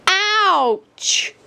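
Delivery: sharp high yelp of pain; ouch cry. A narrator's reading. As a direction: yelp like a pricked little rabbit. Daisy hurt by thistle.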